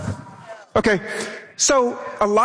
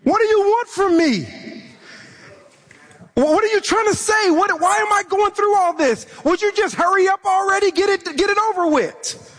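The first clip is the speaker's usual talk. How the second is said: higher, fed-up pleading; frustrated cry to God; 6 semitones higher than usual. Retold or imitated. A sufferer crying out to God, fed up. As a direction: voice someone worn down, demanding answers. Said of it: "so frustrated and your scenario's been so severe".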